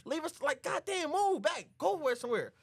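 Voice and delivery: high, agitated shooing; mock exasperated order. [name] orders Roland back from door, high exasperation.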